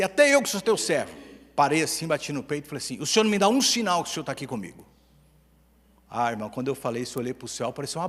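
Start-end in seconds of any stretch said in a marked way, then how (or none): 0.0-1.1 loud plea. Retold or imitated. Crying out to God in prayer. 3.0-4.9 demanding plea. Retold or imitated. Beating chest, demanding a sign.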